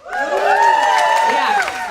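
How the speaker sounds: a high, loud squeal of yes; squealed yeah. Real, not acted. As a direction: squeal it out excitedly. Excited response to audience.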